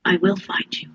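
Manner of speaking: breathy menacing whisper; eerie whisper. Acted. Warlock promising goblin help, eerie whisper. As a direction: whisper the promise like a dark threat.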